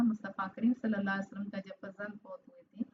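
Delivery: ordinary talk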